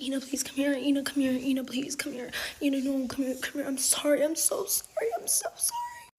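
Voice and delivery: breathy pleading that breaks into a high sob; begging and crying. Retold or imitated. Boyfriend begging her to come to him, crying. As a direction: plead softly, then cry the apology.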